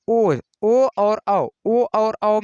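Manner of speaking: ओ and औ drawn out very slowly and loudly; slow loud demo. Shown as a demonstration. Teacher demoing ओ and औ very slowly and loudly. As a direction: stretch ओ and औ slowly, lips rounding. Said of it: "ओठ का प्रयोग".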